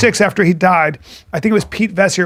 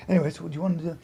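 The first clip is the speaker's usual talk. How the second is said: much softer imitated chat; hushed aside; 3 semitones lower, 14 dB quieter, much faster than usual. Guy talking to his wife during the show, hushed aside. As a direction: drop right down as if talking privately.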